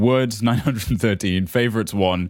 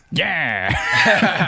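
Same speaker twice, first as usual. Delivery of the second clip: purring Waluigi; 6 semitones higher than usual. Acted. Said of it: "purred the violent mage".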